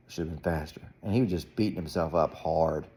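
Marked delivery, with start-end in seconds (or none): 0.1-0.8 sulky mutter. Retold or imitated. Son sulking after race.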